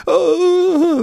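put-on high childish oh, half crying; mock wail. Preacher mimicking a scared child at the doctor. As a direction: play a frightened child.

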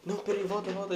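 earnest panicked restart; flustered comedian. Retold or imitated. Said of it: "metendo serinho". (comediante afobado)

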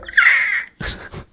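high unvoiced raptor screech; shrill dinosaur shriek; shrill screeching wyvern. After asked to do raptor noise.

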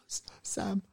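high breathy Frodo calling Sam. Acted. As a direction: call for Sam in a high whisper.

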